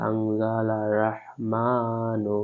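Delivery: আনজালার রহমানু drawn out slowly with held vowels; lengthened vowels. Shown as a demonstration. Teacher demonstrating one-alif lengthening on রহমানু. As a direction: pull out রহমানু, chanting slowly. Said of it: "এখানে এক আলিফ টেনে পড়তে হবে".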